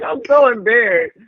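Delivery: ordinary talk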